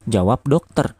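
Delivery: ordinary delivery, nothing marked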